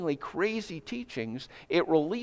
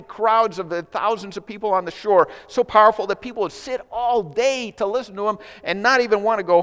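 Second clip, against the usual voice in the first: higher, louder, driving preacher cadence; preaching with rising fervor; 5 semitones higher, 4 dB louder than usual. Acted. Pastor stirring the congregation about Jesus' power, fervent preaching. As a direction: hammer each "so powerful" home to the congregation.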